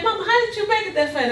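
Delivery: high, quick amazed question. Retold or imitated. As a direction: daughter surprised her mother arrived so fast, high and quick. Granddaughter amazed at quick arrival.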